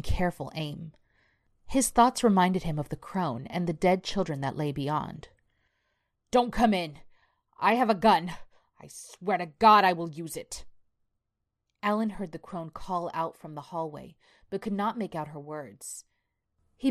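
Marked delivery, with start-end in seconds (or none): 6.3-10.6 raised, urgent threat; frightened warning. Acted. Alan warns whoever is behind the door, alarmed threat. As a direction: warn off an intruder in alarm.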